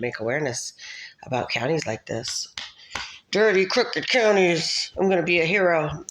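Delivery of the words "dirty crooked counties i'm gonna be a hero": mock proclamation. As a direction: proclaim it like a campaign slogan. Mock declaration against the counties.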